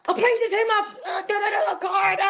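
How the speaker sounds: high-pitched imitation of a panicked caller; retold frantic call. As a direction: blurt it high, as if reporting a crash. Retelling frantic crash phone call.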